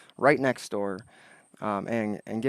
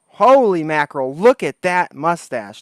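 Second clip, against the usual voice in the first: slow, louder, drawn-out amazement at "look"; amazed; 3 semitones higher, 9 dB louder than usual. Real, not acted. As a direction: stretch out "look" in disbelief, louder. Amazed by guest's mustache.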